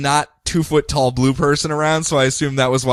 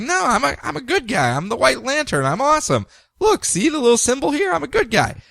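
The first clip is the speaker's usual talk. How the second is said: high, eager protest; pleading high voice; 10 semitones higher than usual. Retold or imitated. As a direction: insist you're the good guy. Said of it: "He's like".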